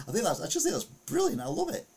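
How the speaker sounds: high excited gush, bursting with glee; delighted squeal. Real, not acted. Vlogger reacts to the Byron Bay vandals, high delighted squeal.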